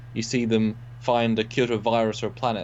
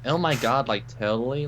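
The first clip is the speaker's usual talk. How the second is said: exaggerated American accent. Shown as a demonstration. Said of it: "do your best American accent".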